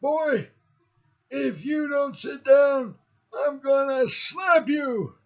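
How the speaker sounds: high, slow, drawn-out scolding; imitating a threatening teacher. Teacher warning a boy to sit down, high slow impression. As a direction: threaten the pupil in a high-pitched mock-teacher voice. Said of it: "she used to talk like this".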